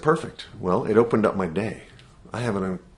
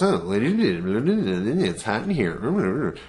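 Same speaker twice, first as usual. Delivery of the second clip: slow, drawn-out whiny complaint; monkey mind voice; much slower than usual. Retold or imitated. Inner monkey mind whining about the heat, very slow. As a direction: stretch it out like a grumbling inner voice. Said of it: "our monkey mind goes".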